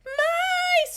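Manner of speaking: very high, loud squeal of alarm; piercingly high, drawn-out cry; little girl's scream. A narrator's reading. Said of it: "screamed a little girl".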